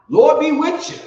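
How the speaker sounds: high, loud exclamation; mock calling out. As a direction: call it out high over the room. Calls out a stock warning phrase.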